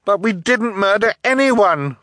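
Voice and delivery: put-on high, loud girl's protest; desperate high-pitched denial; protesting cry. A narrator's reading. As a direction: cry out your innocence. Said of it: "Violet cried".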